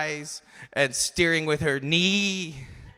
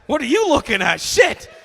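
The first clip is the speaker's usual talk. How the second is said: high, loud yell ending in a shriek; alarmed outburst; 10 semitones higher, 9 dB louder than usual. Retold or imitated. Comic acting out the car ride with mom, alarmed shout. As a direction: shout it, leaping up on the last word.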